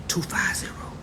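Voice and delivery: whispered digits; whispered two five zero. Real, not acted. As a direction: breathe the digits out with no voice. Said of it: "two fifty".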